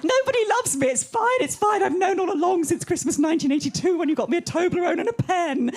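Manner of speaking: high, loud wounded wail from mum; mental mum. Retold or imitated. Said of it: "she goes fucking mental".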